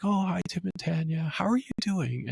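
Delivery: lightly voiced old lady greeting; elderly lady. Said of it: "this elderly lady was like".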